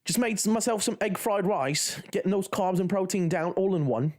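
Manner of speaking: high, cheeky London "me back, innit"; mock English accent. Streamer hamming up a Cockney accent for chat. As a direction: put on a jokey Cockney lilt. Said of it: "speaking in this English accent".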